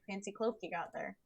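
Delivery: soft, deadpan delivery; dry monotone, mildly put out at being interrupted. Acted. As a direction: keep it unreadable, sarcastic or just annoyed. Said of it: "a very monotone".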